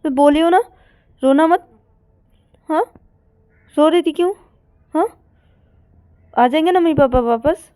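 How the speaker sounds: high, loud, insistent chiding; emotional scolding. Acted. Partner cannot bear Payal's tears, emotional scolding.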